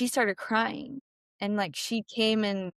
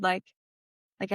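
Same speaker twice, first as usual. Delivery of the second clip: ordinary delivery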